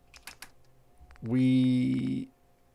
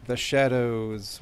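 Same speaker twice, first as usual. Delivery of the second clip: slow theatrical villain menace; much slower than usual. Acted. Player voicing masked vigilante.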